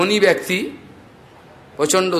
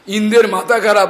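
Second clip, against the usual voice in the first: ordinary talk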